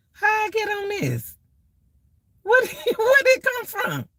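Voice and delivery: high, puzzled squeal with a laugh; baffled self. Retold or imitated. Retelling finding a weird video, high baffled squeak. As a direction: squeak it out, lost on a strange video. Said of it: "I be like".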